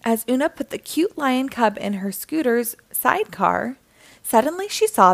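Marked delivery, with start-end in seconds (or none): none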